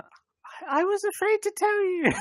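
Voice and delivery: very high put-on girl voice; girl's voice. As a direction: squeak it out as a pretend girl. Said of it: "do it in a girl's accent".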